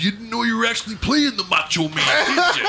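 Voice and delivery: high, hammed-up wrestler promo; Macho Man impression. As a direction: play the Macho Man taunting. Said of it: "I would love to do that".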